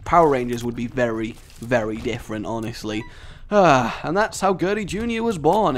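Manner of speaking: usual speaking voice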